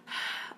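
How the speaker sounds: soft, voiceless H exhale; demonstrated breath. Shown as a demonstration. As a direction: let out a short puff of air as an H sound. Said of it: "a breath".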